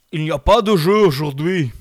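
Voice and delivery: a hammed-up Russian narrator announcing no game; Russian accent. Imitating the Russian narrator in a mock Russian accent. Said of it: "qu'il a un accent russe".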